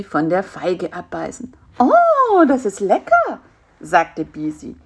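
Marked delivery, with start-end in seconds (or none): 1.8-3.4 very high, rapturous fly voice; delighted. Acted. Fly tastes the fig spider fed it, delighted. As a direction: savour it with delight.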